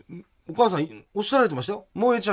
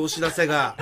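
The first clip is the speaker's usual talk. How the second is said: ordinary talk, nothing marked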